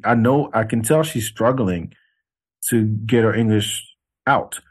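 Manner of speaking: usual speaking voice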